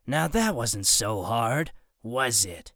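low, slow, gloating menace; menacing villain. Acted. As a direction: lean in low and smug.

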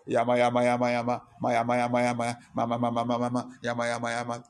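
low monotone mumbo-jumbo chant; imitating rote, droning prayer; mock prayer babble. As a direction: rattle off nonsense syllables on one low note. Mimics rattled-off prayer while fasting.

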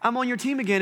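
a fickle crowd cheerfully coming back; hammed-up casual return to God; mock relieved. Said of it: "We're like".

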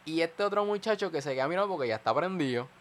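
ordinary talk

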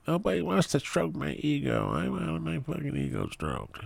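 high, petulant mocking whine; whiny Ninja impression. Mocking Ninja's ego, high and whiny. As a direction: whine like a spoiled streamer. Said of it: "you're all mad and shit".

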